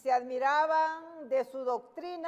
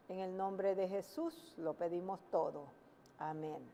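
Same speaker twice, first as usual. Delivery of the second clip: prayer; 4 semitones lower than usual. Real, not acted. Preacher closing a prayer before the sermon, hushed prayer.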